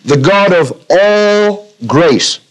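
slow phrase with "all" stretched out; stress on all. Shown as a demonstration. Preacher repeating the verse for emphasis, stress on "all". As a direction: dwell on "all", drawing it out slowly. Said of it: "Underline the word all grace".

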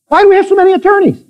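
emphatic repeat. Acted. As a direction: fire it at the congregation. Same question repeated, preaching harder.

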